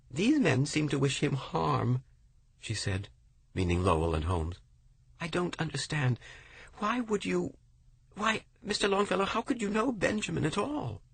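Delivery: high, worried woman's voice; confused and pleading, pitched high; the widow questions the poet, high and distressed; anxious widow. A narrator's reading.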